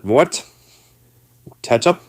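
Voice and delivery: high, loud questioning rise; mock baffled. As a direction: repeat the new word in loud disbelief. Baffled at hearing the new word.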